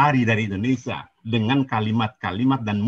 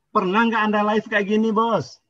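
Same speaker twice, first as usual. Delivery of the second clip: higher, taunting dare; heated challenge; 9 semitones higher, 3 dB louder than usual. Real, not acted. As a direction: throw the dare at him, voice up high.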